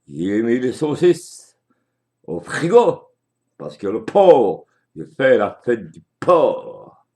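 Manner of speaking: put-on loud, brash old politician voice; Le Pen impression. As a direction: bellow like a blustering old politician. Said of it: "Bien, monsieur Le Pen".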